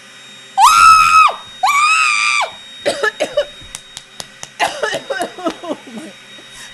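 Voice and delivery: long high-pitched scream; high shriek. Real, not acted. Shriek over music.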